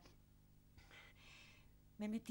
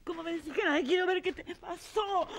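frightened; 7 semitones higher, 4 dB louder than usual. Acted. Frightened questions about the blood.

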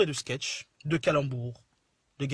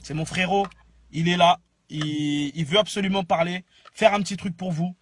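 ordinary talk, nothing marked

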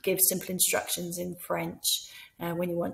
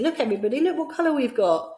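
excited teacher call; 6 semitones higher, 4 dB louder, faster than usual. Shown as a demonstration. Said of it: "just by pointing".

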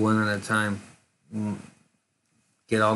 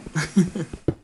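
laugh; 7 semitones higher than usual. Real, not acted. Laughing after joke.

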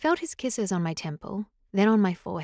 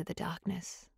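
whisper; 3 semitones lower, 13 dB quieter, slower than usual. A narrator's reading. Chapter end fade.

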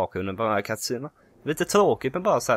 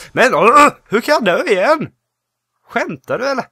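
frustrated; 10 semitones higher, 9 dB louder than usual. Real, not acted. Dies again in game.

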